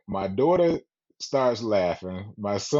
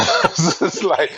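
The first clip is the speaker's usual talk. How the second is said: laughing through the words; can't stop laughing while telling it; laughing loudly while retelling the plane story; 6 semitones higher, 8 dB louder than usual. Real, not acted.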